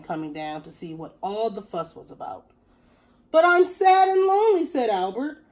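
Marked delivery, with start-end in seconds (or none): 3.3-4.7 high, loud puppy-ish whine; sad dog voice. Acted. Lonely dog pleading with the cat to come home.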